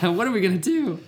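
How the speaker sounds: high, excited then panicking; hammed-up exec freaking out; mock studio exec.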